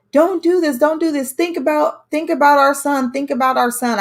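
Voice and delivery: high, pleading cries of don't do this; desperate pleading. Retold or imitated. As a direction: beg him to spare her for their son's sake. Girlfriend begging him to stop.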